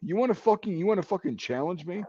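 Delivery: high-pitched, goading questions; incredulous, rising challenge; challenging drill sergeant. Retold or imitated. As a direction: imitate a sergeant daring a recruit. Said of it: "my drill sergeant".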